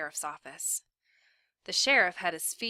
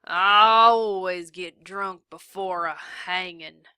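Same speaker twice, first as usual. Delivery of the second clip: put-on drunk drawl; 11 dB louder, slower than usual. A narrator's reading.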